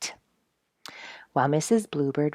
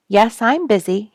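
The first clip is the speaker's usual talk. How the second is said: higher, louder hurried mole voice; lightly voiced busy mole; 6 semitones higher, 7 dB louder than usual. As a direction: answer briskly, eager to get back to digging. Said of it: "he said".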